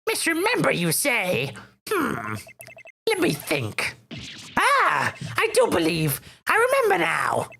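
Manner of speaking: put-on high, theatrical old man; old mayor voice. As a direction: ham up the pompous old mayor.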